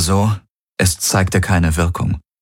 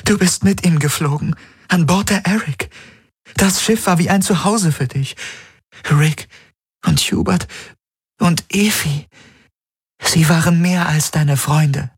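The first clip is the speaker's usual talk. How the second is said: high, rising, desperate plea; pleading young woman; 12 semitones higher than usual. Acted. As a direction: beg him to remember. Kirai keeps pleading.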